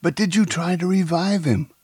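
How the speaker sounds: high, loud frantic pleading. A narrator's reading. Woman asks accomplice about reviving the victim, frantic. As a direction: press urgently for an answer. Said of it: "she asked frantically".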